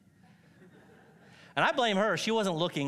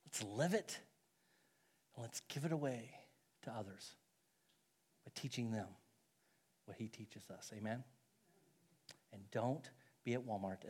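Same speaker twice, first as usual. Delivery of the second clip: slowing, fading softer as he winds down; closing sermon; 6 semitones lower, 7 dB quieter than usual. Acted. Pastor closing his sermon to the church, growing quiet.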